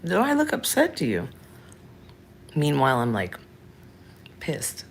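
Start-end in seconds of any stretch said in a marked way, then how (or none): 0.0-1.3 high, mock-innocent challenge; turning it back on them. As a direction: throw the question back, pitched way up. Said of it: "flip it around on them".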